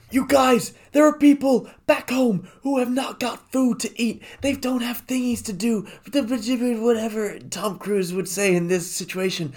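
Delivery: high-pitched mock-angry rant at the crew; badly faked movie-star outburst, hammed up; Tom Cruise. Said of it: "a very bad Tom, Tom Cruise impression".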